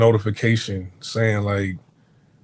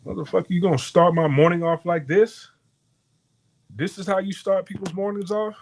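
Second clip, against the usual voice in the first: higher, louder mock-angry retort; heated comeback; 8 semitones higher, 3 dB louder than usual. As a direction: snap back high, offended. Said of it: "I'm like".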